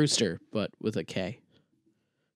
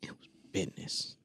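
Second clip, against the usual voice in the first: whisper; 7 semitones lower, 15 dB quieter than usual. Pretend. Whispered banter echoing.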